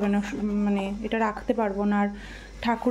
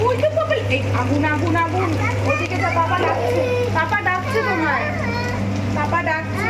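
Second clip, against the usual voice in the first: sing-song scolding; 7 semitones higher than usual. Real, not acted. Mother warning her toddler in the kitchen, high singsong. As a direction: warn the little one off, bright and high.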